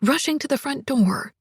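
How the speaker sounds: plain reading voice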